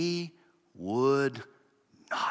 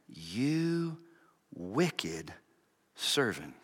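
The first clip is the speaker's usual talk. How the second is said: low, quiet, halting condemnation; stern master, hushed and deliberate; grave rebuke; 4 semitones lower, 8 dB quieter, much slower than usual. Acted. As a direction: pronounce judgment softly, pausing after each word. Master's stern rebuke, read slowly.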